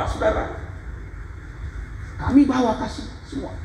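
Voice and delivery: high, insistent pulpit cry; fervent preaching. Real, not acted. Preacher telling how they brought everything, fervent. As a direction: start very high and insist on each phrase.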